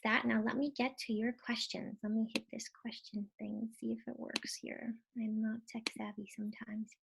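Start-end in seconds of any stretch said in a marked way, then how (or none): 2.0-6.9 soft whisper aside while clicking around; whispering to herself. Real, not acted. Presenter stuck on the webinar tool, whispering to herself. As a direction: mutter it under your breath, half to yourself.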